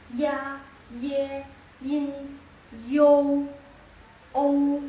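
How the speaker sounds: AI, AN, AU and the rest loud and level, one by one; reciting vowels. Shown as a demonstration. Teacher reviewing today's vowels, loud level recitation. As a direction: hold each sound from AI to YUN on an even pitch. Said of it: "Vokal yang kita belajar hari ini".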